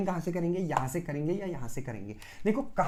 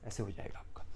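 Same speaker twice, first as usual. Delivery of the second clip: whisper; 9 semitones lower, 13 dB quieter than usual. Real, not acted. Teacher drops voice mid-explanation.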